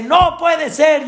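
high, mock outraged cry; hammed-up father protest; father shouting in disbelief. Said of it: "y empezó a gritar".

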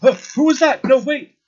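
high, loud alarmed shout; man's panicked, high-pitched yell; startled yell. Acted. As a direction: yell out in sudden alarm from behind a door. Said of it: "you hear a man yell".

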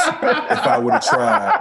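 laughing. Real, not acted. Laughing at own joke.

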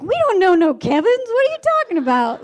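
high, loud mock disbelief; incredulous protest. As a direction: imitate kids loudly objecting. Laughing kids protest the fortune.

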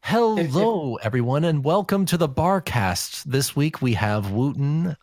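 high, lilting podcast host welcome; Flutter Priest. Acted. Host welcoming listeners to the Barcast on request, high put-on impression. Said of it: "what is your best Flutter Priest impression".

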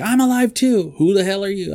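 high-pitched hammed-up yell; mock yell. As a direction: blurt it out loud like a startled survivor. Retold movie scene, shouted quote.

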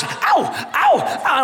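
put-on high, sharp yelps of a hurting boy; child in pain. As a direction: yelp like a four-year-old with a splinter. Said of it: "he's hobbling around the house".

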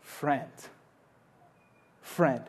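soft, lingering friend, friend; tender appeal. Acted. Master entreats worker, soft tender repetition. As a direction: say it gently, like an invitation. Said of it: "he says to him".

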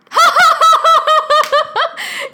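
loud, high mock guffaw; long, high-pitched imitated belly laugh; dad's big laugh. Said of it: "Why is he laughing?".

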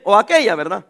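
ordinary delivery

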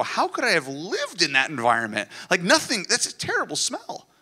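high, disgusted outburst about the smell; hammed-up horror at a stinking cabin; mock disgust. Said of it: "you go".

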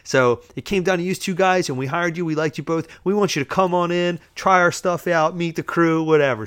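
high, loud, upbeat sales patter; enthusiastic boss. Retold or imitated. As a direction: imitate an eager, welcoming boss. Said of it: "He's very enthusiastic".

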